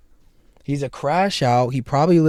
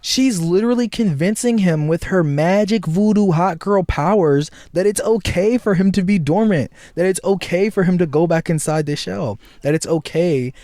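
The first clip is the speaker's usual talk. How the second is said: indignant rant; 6 semitones higher than usual. Real, not acted. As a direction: push it with disbelief. Outraged commentary.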